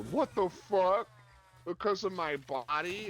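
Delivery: indignant actress. Retold or imitated. Actress told she can't play Rose, protesting in a high mock-offended voice.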